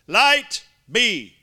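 a touch of the command; firm proclamation. Shown as a demonstration. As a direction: state it with authority. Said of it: "Our text says".

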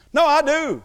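high, loud mock protest; insistent student. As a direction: imitate an eager student insisting. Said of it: "one student, he's nineteen years old".

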